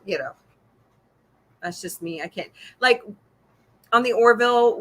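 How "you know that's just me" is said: ordinary talk, nothing marked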